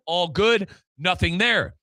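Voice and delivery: ordinary talk, nothing marked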